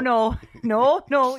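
loud, high, insistent protest; exaggerated indignant objection; mock outrage. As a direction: shut it down loudly. Mock outrage at pretentious pronunciation.

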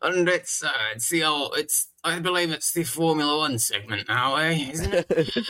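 lightly voiced posh pensioner; elderly toff in a care home; posh old man. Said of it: "Why are we talking like posh people?".

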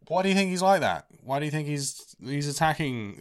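high-pitched put-on pleading question; mock indignant questioning. Louis confronting the bully's dad, high mock indignation. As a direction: push the pitch right up, imitating an exasperated complaint.

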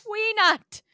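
hammed-up alarmed shout, high and loud; panicked researcher. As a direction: yell it like an anxious scientist in a panic. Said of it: "he turns to you and goes".

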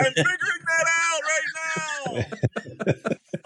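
high, slow, stretched-out holler; mock yelling back. Driver answering his friend's warning, mock holler. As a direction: call back in comic exasperation.

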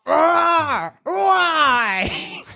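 high anguished ogre shout; pained ogre cry. Acted. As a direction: wail it like a wounded ogre. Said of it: "The ogre reaches up".

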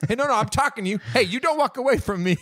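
mock stern cop, rising high and pushy; bossy cop voice. Journalist interrogating the cop, imitating a pushy stern cop. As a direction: grill him like a cop grills a suspect. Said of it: "talk to a cop like a cop talks".

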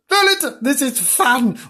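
high, excited animal voice; Bernard's voice. Acted. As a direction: squeak it out eagerly. Bernard continues his line.

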